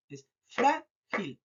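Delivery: "frágil" very slowly as FRÁ, gil; stress on first syllable. Shown as a demonstration. Teacher confirming the answer, stress on FRÁ of "frágil". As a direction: stretch FRÁ in "frágil", then a separate gil. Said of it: "se carga en".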